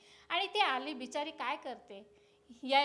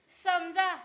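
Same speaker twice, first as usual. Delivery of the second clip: usual speaking voice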